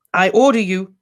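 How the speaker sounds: high, loud and commanding; fierce. A narrator's reading. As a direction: call him sharply to order. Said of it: "said Harry fiercely".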